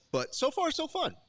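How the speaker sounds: high, bright so far, so fun; excited. Real, not acted. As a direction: lift it way up with joy. Upbeat sign-off remark.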